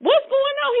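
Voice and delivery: high-pitched, worried questioning; mock alarm. Joshua hears shouting from the camp, high mock alarm. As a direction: imitate someone alarmed by a commotion, pitch jumping up. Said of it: "He thinking they in trouble".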